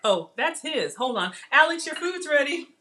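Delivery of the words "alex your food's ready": higher, carrying call; yelling across the room. Real, not acted. Calling son from kitchen.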